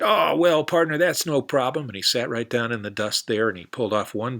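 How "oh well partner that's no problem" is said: higher, louder cowboy voice; eager cowboy, higher and louder; hearty cowboy. Acted. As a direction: boom it out like a big-hearted cowboy. Cowboy obliging.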